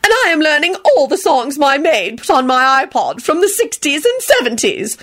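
high, lilting society lady; airy, pleased grande dame; happy trill. A narrator's reading. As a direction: trill it with self-satisfaction. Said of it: "she trilled happily".

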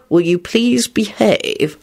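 slow, wary request; wary plea. A narrator's reading. Lupin asks Rosie to behave, warily. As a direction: ask slowly and warily. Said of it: "Lupin asked her warily".

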